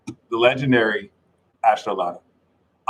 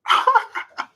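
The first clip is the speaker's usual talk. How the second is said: high breathy laugh. Real, not acted. Laughs at own joke.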